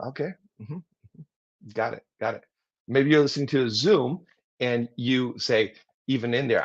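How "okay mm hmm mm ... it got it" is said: much softer mm-hmms, nearly breathed; quiet acknowledgement. Shown as a demonstration. Listener leaning in during a presentation, soft murmurs. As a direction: murmur along so you don't interrupt. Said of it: "you lean in and go".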